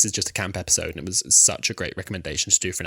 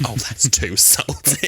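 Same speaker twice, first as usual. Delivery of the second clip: laughing imitation; 2 semitones higher, 4 dB louder, slower than usual. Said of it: "and she's like".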